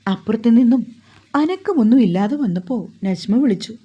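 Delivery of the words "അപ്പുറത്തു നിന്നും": usual speaking voice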